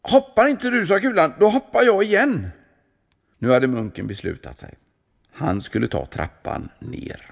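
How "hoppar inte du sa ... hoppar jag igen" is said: urging. A narrator's reading. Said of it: "Kulan manade på honom".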